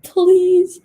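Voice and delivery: high, loud pleading yes; eager yes. Retold or imitated. Student answering teacher's hug offer, high loud please. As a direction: blurt it out, desperate for the hug.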